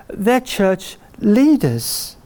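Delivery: slow, weighty delivery; slow and deliberate. Acted. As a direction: land each word slowly. Slowed emphatic line.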